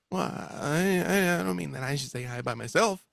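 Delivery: high, faltering protest; flustered stammerer. Acted. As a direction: stammer and back away from the idea. Timid voice backs down, flustered.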